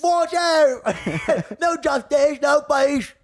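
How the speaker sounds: high, cockney gravedigger voice; gravedigger guy. Retold or imitated. Comic becoming the gravedigger guy, high cockney voice. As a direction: become the lowly graveyard minion. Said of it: "Now I'm becoming the gravedigger guy".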